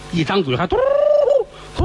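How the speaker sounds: hollow ghost hoot "dudu"; ghostly hoot. Acted. Storyteller imitating a ghost's hoot.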